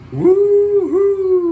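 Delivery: high, loud whoop of delight; excited cheer. Real, not acted. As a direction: cheer the jet on at full voice. Cheering the stunt jet pass.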